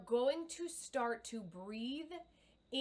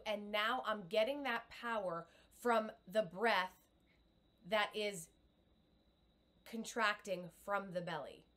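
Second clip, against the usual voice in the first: ordinary delivery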